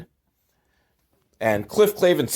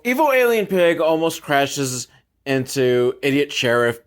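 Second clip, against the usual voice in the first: normal talking voice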